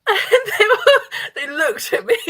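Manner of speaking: high, laughing words. Real, not acted. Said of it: "laughing while telling".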